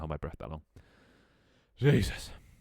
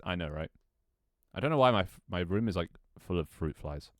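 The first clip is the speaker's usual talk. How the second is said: ordinary talk, nothing marked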